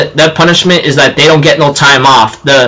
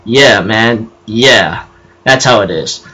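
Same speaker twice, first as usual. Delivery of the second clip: lower, laid-back "yeah, man"; laid-back agreement; 6 semitones lower, 3 dB quieter, slower than usual. Retold or imitated. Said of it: "and I go like".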